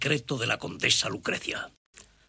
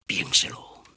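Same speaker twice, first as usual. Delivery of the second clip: whispering; slower than usual. Acted. Veiled threat.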